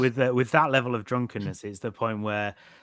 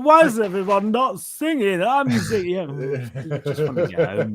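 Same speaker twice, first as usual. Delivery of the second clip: mock drunk; 7 semitones higher, 3 dB louder than usual. Imitating a drunk on the last train home, mock merry.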